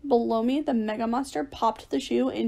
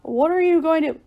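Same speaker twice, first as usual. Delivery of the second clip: high, louder baffled question; puzzled question; 6 semitones higher, 5 dB louder than usual. A narrator's reading. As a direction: blurt it in confusion, cut off. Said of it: "Mister Williams looked puzzled".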